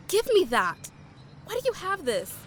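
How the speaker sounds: sharp demand. Acted. Snatching the gun.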